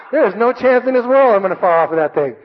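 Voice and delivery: high, loud, emphatic protest; insistent self-reassurance. Retold or imitated. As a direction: insist loudly over a churning stomach. Frantic self-reassurance at great height.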